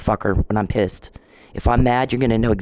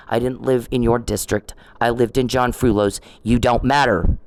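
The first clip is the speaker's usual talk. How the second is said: ordinary talk, nothing marked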